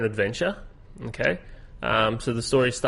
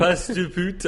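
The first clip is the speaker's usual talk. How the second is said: French accent; 6 semitones higher, slower than usual. Shown as a demonstration. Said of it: "I always read it like that".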